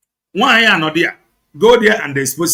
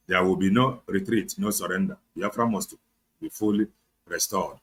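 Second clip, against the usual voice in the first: solemn slogan; 9 semitones lower, 8 dB quieter than usual. Acted. Recited slogan sign-off.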